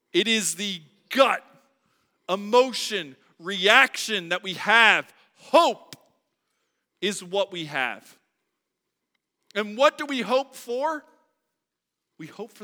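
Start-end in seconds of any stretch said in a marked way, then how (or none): 0.1-11.0 loud, rising pulpit cry; preaching at full force. Acted. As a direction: build it up, hammer each word. Preaching louder.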